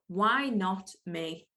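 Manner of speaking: slow, weighty repeat of "Why not me?"; slow, deliberate. Shown as a demonstration. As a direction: let "Why not me?" sink in slowly. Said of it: "Well, why not me?".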